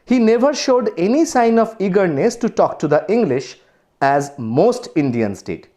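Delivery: slow, deliberate textbook reading; story read-aloud. Acted. Teacher reads the story to students, slow read-aloud. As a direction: read the English text out slowly and carefully.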